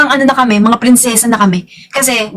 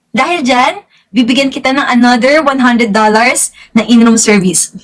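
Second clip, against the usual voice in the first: ordinary talk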